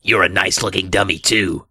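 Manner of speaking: hint of a harsh dummy voice; understated raspy dummy; lightly raspy growl. A narrator's reading. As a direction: sneer it back as a mildly growling puppet. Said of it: "raspy growl of a voice".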